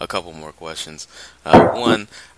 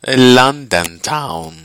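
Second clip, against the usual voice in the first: slow, loud mock British accent; 5 semitones higher, 12 dB louder, much slower than usual. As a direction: drawl it like a Londoner. Mocking London accent banter.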